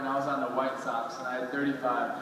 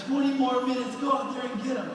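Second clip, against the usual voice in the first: coach pep talk; 10 semitones higher than usual. Retold or imitated. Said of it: "It's like".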